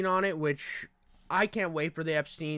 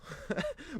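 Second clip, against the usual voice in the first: laugh; 10 semitones higher, 10 dB quieter than usual. Real, not acted. Laughs at own bit.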